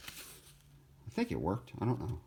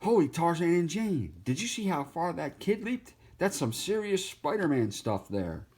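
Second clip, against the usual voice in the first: high, eager cartoon monkey voice; excited monkey; 8 semitones higher than usual. Cartoon monkey marvelling at a leaping kid, high and excited. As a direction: squeal it out like an amazed monkey. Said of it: "this monkey going".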